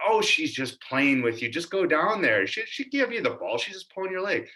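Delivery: high-pitched imitation of a dismissive dad; imitating dad. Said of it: "And he's like".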